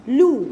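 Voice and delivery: the letter sound lu. Shown as a demonstration. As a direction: damma sound lu. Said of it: "لام ضمة لو".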